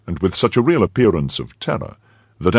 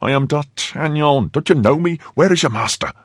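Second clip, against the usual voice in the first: raised pitch, impatient questioning; urgent insistence; 9 semitones higher than usual. Acted. Disguised young man confronts the servant, urgent insistence. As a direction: insist urgently on being recognised.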